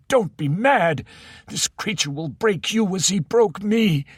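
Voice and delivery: put-on high, frantic old voice; near hysteria. Ocken begging Andy to give in, near-hysterical. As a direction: plead in rising terror. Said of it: "close to hysteria".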